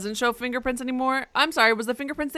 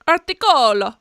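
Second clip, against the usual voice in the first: punchy, louder Italian-style word; Italian accent; 10 dB louder than usual. Shown as a demonstration. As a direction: say it loud, like an Italian. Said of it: "in Italian".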